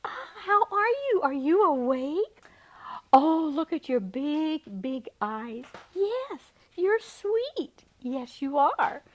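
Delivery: high, slow, drawn-out cooing; baby talk. Retold or imitated. Family cooing baby talk to the little fawn. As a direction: coo over the baby like a doting parent.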